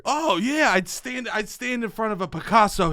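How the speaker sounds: usual speaking voice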